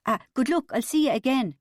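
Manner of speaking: startled, hasty farewell; hurried. A narrator's reading. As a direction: get the goodbye out in a hurry. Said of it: "he said hurriedly to Bubbly".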